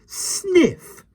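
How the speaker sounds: high, sharp indignant huff; a high, sniffy nanny; indignant sniff. A narrator's reading. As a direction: sniff it out offended. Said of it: "she said, sniffing".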